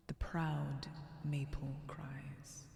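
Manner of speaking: slow, soft whisper, savouring each word; whispered recitation. Acted. Player reciting the Kvasir poem, slow whisper. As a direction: breathe the line like a riddle. Reading the found poem aloud.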